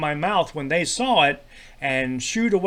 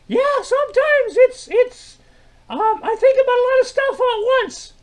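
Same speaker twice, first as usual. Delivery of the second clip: high-pitched puppet sidekick; 6 dB louder than usual. Acted. Troy answers Milt's greeting in a very high sidekick voice.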